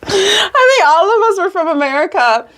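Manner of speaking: high voice, near tears from laughing; overcome with laughter. Real, not acted. All of us were from America, overcome with real laughter. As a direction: struggle to explain through helpless amusement.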